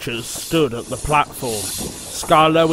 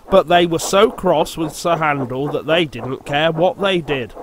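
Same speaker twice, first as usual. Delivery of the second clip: ordinary delivery, nothing marked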